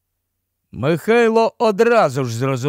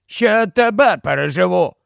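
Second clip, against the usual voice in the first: put-on higher-pitched, huffy old man; indignant; 4 semitones higher than usual. A narrator's reading. Old man answers the laughing fat man, indignant. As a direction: fire back with understated outrage. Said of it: "обурився старий".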